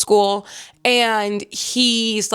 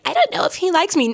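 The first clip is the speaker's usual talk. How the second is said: mock clueless heroine; 8 semitones higher than usual. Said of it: "And she's like".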